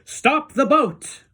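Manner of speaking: high, loud and slow; shouted. A narrator's reading. Wonka halts the boat, shouting. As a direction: yell it to stop everyone.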